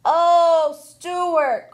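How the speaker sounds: loud, high dismayed call; disappointed cry. Acted. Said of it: "cried a disappointed mother".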